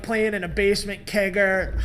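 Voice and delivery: usual speaking voice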